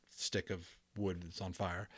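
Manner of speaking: ordinary delivery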